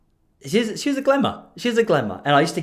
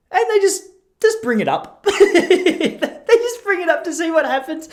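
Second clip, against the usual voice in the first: laughing; 4 dB louder than usual. Real, not acted. Gleefully describing provocateurs, laughing.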